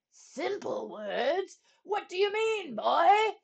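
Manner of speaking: drawn-out echo, climbing high and loud; incredulous king. A narrator's reading. King challenging the boy's advice, incredulous. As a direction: question it with royal indignation. King echoes boy in disbelief.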